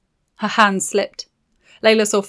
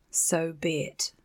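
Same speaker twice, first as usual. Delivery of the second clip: slow, soft menacing witch growl; 2 semitones lower, 9 dB quieter, slower than usual. Acted. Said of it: "the witch growled".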